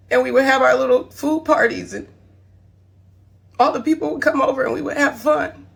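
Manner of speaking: holding back sobs while apologising; tearful. Real, not acted. Mother apologising to her children online, tearful and strained. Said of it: "I'm trying my best not to cry".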